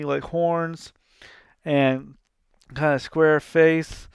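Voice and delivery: ordinary talk, nothing marked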